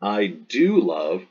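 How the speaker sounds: slow, weight on do; deliberate emphatic demo, do pressed hard; emphatic form. Shown as a demonstration. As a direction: land on do as if insisting, I do love. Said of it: "is English's emphatic form".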